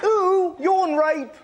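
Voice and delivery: a bloke pouncing with a playground prank; mock delighted shriek; gleeful yelp. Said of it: "popped his finger in his mouth and went".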